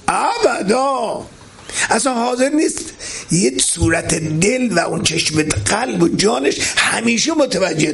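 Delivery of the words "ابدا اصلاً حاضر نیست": emphatic cry. Acted. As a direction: stretch ابدا into a long ringing shout. Preacher answers his own question. (ابدا را کشیده و با فریاد بلند بگو)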